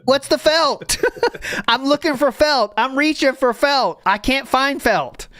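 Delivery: high, playful mock search; hammed-up playful searching.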